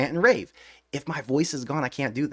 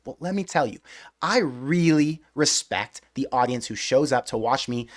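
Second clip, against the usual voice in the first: ordinary talk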